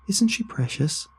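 high, slow and doting; doting mother. Acted. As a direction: coo over the baby, slowly and high. Mother shows her baby.

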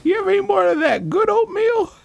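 put-on high cackly old-timer; old prospector voice. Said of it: "Use your old prospector voice".

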